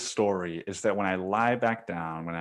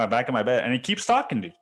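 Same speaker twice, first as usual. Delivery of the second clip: normal talking voice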